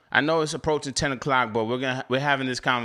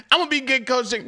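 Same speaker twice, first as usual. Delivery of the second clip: "I'm gonna be Get Coach Jake" said through laughter, high; laughing; 9 semitones higher, faster than usual. Real, not acted. Repeating his vow to chat, laughing through it. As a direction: say it while still laughing at yourself.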